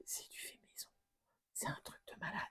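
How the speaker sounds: soft awed whisper; chuchoté, émerveillé. Real, not acted. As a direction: whisper as if not to break the spell. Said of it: "Je sais pas pourquoi je chuchote comme ça".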